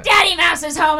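high-pitched, hammed-up attack cry; mock menacing shout. Grandpa digging his nail in, shouting in mock menace. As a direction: imitate grandpa pouncing with a shout.

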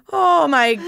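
ordinary talk

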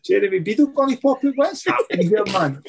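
high, bewildered what's-going-on; baffled outburst. Retold or imitated. Retelling his own alarmed reaction.

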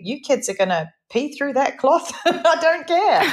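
giggling I-don't-care; laughing mock defiance. Mother telling her kids she doesn't care, laughing. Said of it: "It would be".

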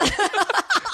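very high squeal of laughter. Real, not acted. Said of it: "I squeal".

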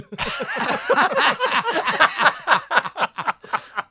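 high, loud burst of laughter; big laugh. Real, not acted. Guest laughing hard at the muffled-voice punchline, high and loud. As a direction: crack up at the punchline.

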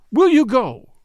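a loud, high demand; insistent. A narrator's reading. A young lady orders a stranger out of her cab, loud and high. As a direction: snap it out firmly. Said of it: "she insisted".

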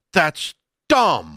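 DUMB stretched long with a falling tune; drawn-out dumb. Real, not acted. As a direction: lean hard on dumb. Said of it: "you added an extra syllable to that dumb".

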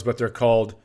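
ordinary talk

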